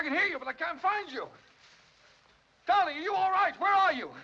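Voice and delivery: high, loud worried calls; anxious shout. Acted. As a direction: call out into the dark for her. Still searching, shouting.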